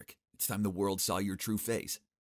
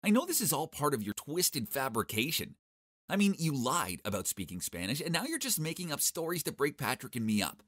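snapping; 7 semitones higher than usual. Acted. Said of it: "she snapped at me".

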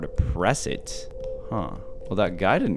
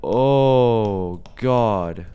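scared gasp. Real, not acted. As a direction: caught by a jump scare. Jump scare in horror game.

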